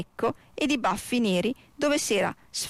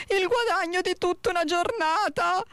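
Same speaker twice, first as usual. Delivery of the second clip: dismayed exclamation; 8 semitones higher than usual. A narrator's reading. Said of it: "pensò".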